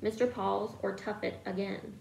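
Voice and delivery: plain reading voice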